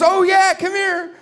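very high, loud eager call; chirpy wife voice. Retold or imitated. Wife eagerly calls husband into the kitchen, very high.

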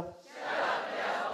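quiet echo. Acted. Congregation repeats after preacher.

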